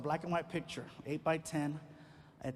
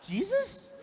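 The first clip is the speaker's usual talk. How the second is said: put-on kid's high guess; 5 dB louder than usual. Kid guess, teller retort.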